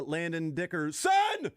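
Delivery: Dickerson drawn out slowly with the weight on its last syllable; slow mock-emphatic Dickerson; stress on Dickerson. Shown as a demonstration. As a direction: lean on the "son" of Dickerson, slowly. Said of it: "he put an emphasis on a certain syllable".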